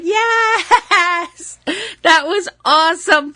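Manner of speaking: high, excited squeal of joy. Retold or imitated. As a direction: squeal it like a thrilled fan. Excited fan reaction retold.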